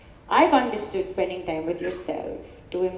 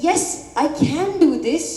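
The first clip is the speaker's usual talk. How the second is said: heartfelt resolve; 7 semitones higher, 6 dB louder than usual. Acted. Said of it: "who truly feel".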